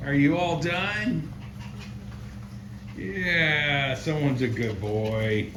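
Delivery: high pet-talk asking if he's finished. Real, not acted. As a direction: coo at the puppy like a pet parent. Talking to the dog.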